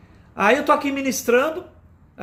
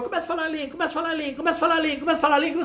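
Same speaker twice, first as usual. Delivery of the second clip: high, rapid repeated chant; mock urging pastor; 7 semitones higher, much faster than usual. Preacher mimics a pastor pushing people to speak in tongues, mock urging. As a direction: mimic a pastor chanting the same prompt, higher pitched.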